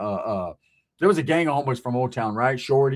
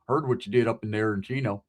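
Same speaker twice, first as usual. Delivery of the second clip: low, softer voice of a big prison wood; big biker-type's voice; 7 semitones lower, 5 dB quieter than usual. Retold or imitated. Huge ex-con greeting a newcomer, low and quiet. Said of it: "how he sounded".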